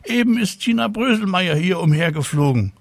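high, excited tattling pupil; schoolboy calling out. A narrator's reading. Mirko telling teacher about flying Tina, calling excitedly. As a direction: report it urgently in a high child's voice. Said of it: "Mirko Rübel rief".

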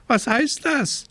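high, fussy scolding raven voice. A narrator's reading. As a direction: play a worried, scolding raven. Raven asks.

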